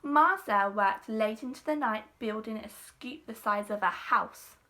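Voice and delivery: ordinary delivery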